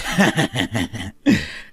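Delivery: low, smug chuckle; gloating laugh. Acted. Husband demanding an apology from his wife, gloating laugh. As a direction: laugh smugly at her expense.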